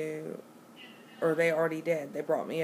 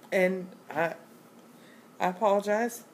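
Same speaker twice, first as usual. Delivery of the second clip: burping mid-sentence; hiccuping while talking; 3 semitones higher, 4 dB louder than usual. Real, not acted. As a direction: speak through a hiccup. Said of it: "I am burping and hiccuping and belching".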